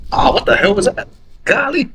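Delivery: high, startled outburst; real jolt of surprise, voice shooting up; appalled golly; shocked disbelief. Real, not acted. Reacting to the quoted line.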